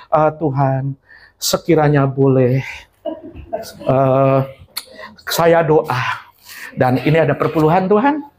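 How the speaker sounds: a coy lovesick believer bargaining with God; whiny believer. Acted. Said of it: "mocking a bribing believer". (orang Kristen merayu Tuhan, suara rendah malu-malu)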